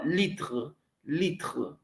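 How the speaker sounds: usual speaking voice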